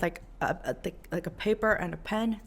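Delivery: usual speaking voice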